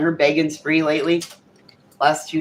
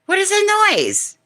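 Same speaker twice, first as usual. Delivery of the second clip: surprised bird owner. Retold or imitated. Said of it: "Birdie's like".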